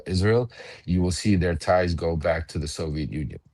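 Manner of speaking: ordinary talk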